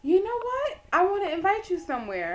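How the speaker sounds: high, loud eager announcement; excited. Retold or imitated. Dean inviting a student somewhere, excited. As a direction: burst out with a thrilled invitation. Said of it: "She was just so excited".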